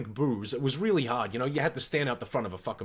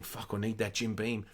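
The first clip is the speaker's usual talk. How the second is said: low, much softer inner voice; inner thought; 7 semitones lower, 9 dB quieter than usual. Retold or imitated. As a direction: mutter the thought to yourself, hushed. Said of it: "I'm just thinking".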